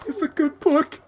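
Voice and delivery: small high lemur voice. Retold or imitated. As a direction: squeak it like a cartoon lemur. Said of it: "then the lemur was like".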